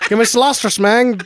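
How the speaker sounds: high, hammed-up Spanglish accent; East Side. Imitating the favorite actor, high Spanglish accent. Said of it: "East Side because he's just like".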